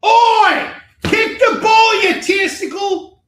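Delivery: high, loud mock coach yell; hammed-up Aussie-style soccer coach shout. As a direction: bellow at the players like an angry coach. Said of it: "He's like".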